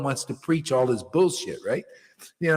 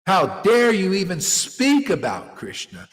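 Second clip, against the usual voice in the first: usual speaking voice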